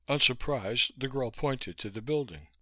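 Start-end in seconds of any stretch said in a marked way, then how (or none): none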